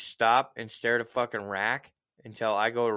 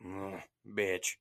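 muttered insult; 6 semitones lower, 10 dB quieter than usual. Retold or imitated. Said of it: "I'd be like".

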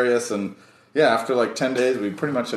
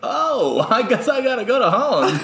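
laughing resignation; 11 semitones higher than usual. Retold or imitated. Reacting to mom's invite.

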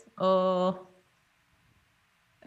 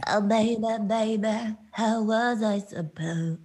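[name]-style creaky vocal fry, slow; slower than usual. Retold or imitated. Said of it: "it's not pure vocal fry".

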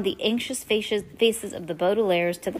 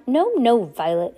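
higher, louder cut-in at the start; interrupting; 4 semitones higher, 6 dB louder than usual. A narrator's reading. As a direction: cut in with a quick correction. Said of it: "Aunt Josephine interrupted".

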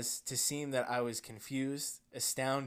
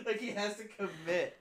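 higher, laughing through the words; can barely get it out for laughing; 10 semitones higher, 6 dB louder than usual. Real, not acted. Host reacting to the story, laughing.

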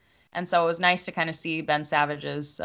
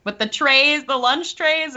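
touched, voice lifting higher; getting emotional; 7 semitones higher, 3 dB louder, slower than usual. Real, not acted. Co-host moved recalling the lunch tray memorial, getting emotional. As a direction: let the feeling push your pitch up. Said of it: "I'm just getting emotional thinking about it".

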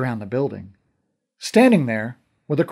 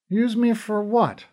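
slow, raised, wary question; suspicious; 10 semitones higher than usual. A narrator's reading. Connor asking Dahi what he'll be used for, suspicious. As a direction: doubt his motive as you ask. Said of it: "I said suspiciously".

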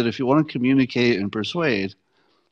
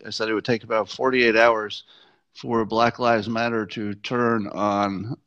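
normal talking voice

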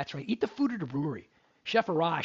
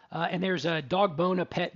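normal talking voice

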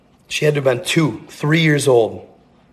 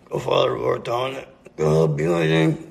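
slurred, sinking into a creaky mumble; struggling to speak; 2 semitones lower, 3 dB quieter than usual. Retold or imitated. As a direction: force out a prayer that won't come. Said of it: "hard for me to say it".

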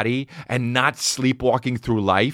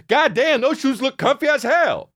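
pitched-up mock holler; loud guy in bathroom. Stranger praising shoes too loudly, hammed-up holler. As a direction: imitate a man shouting across a bathroom. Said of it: "same voice as the guy who said".

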